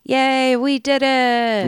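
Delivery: a sarcastic little victory cheer; mock cheer. Mock celebrating the solved case.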